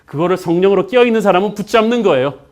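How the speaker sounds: high, loud, excited pulpit storytelling; full-force preaching. Real, not acted. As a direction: proclaim the miracle to the whole congregation.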